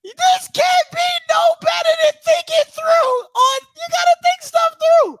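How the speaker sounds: very high squeal of amusement; high-pitched, barely recovered from laughing; overcome with laughter. Real, not acted. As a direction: squeak the words out after laughing hard. Talking through helpless laughter.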